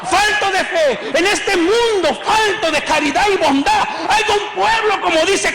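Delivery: alza la voz y predica con fuerza; predicación encendida. Acted. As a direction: clama al pueblo con fervor. (predicador exaltado)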